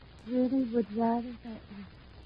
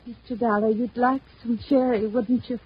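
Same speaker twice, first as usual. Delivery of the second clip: much louder, almost pleading with the guest; urgent loud offer; 10 dB louder than usual. Acted. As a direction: force the offer through before she's stopped. Wife pressing guest, husband forbids.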